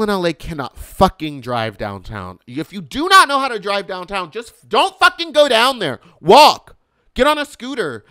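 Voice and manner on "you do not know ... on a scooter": higher and louder exasperated commands; angry rant. Real, not acted. Scolding LA drivers who can't drive downtown, higher, louder angry rant. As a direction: tell them off, fed up.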